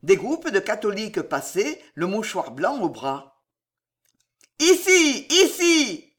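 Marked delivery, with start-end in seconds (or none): 4.6-6.1 very high, loud shouts; howled call. A narrator's reading. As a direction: yell to summon passers-by. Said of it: "hurla Ruggieri".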